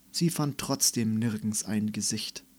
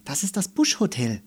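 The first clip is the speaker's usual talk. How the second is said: a touch higher and louder, proud; proud little girl presenting; proudly; 5 semitones higher, 4 dB louder than usual. A narrator's reading. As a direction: show off the bush hotel. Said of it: "sagte Melissa stolz".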